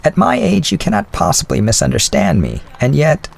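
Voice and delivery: a hint of the old widow's voice; elderly lady voice. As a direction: speak as a kindly older woman. Mrs Callender hesitates.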